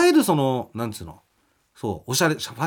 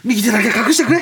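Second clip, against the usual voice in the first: Kansai manager voice; 6 semitones higher, 6 dB louder than usual. Retold or imitated. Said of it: "callback to the manager line".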